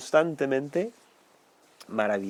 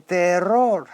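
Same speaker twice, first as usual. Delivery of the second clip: a hammed-up cry of "¡Terror!"; imitating the disciples screaming at a ghost; mock terrified cry; 5 semitones higher, 4 dB louder than usual. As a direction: cry out in mock fright, a bit louder. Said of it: "creen que es un fantasma y gritan".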